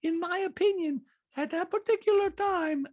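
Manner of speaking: high-pitched put-on impression; Diana. Diana giving her opinion to Sutton, high mock impression. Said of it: "Diana goes".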